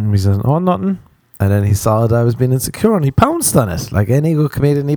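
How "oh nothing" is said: higher, coy "nothing" as a tease; buddy faking innocence to needle him; mock innocent. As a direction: play innocent while teasing.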